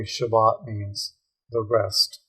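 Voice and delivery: ordinary talk, nothing marked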